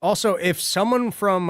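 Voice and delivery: ordinary talk, nothing marked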